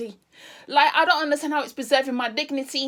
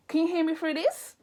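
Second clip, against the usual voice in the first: usual speaking voice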